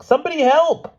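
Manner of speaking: loud, very high call for help; piercing plea; screamed harder. A narrator's reading. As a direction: scream out to anyone in earshot. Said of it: "Lynn screamed all the harder".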